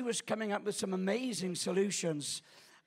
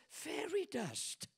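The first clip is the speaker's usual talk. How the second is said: put-on child's secret; 7 semitones higher, 8 dB quieter, slower than usual. Granddaughter voiced in story.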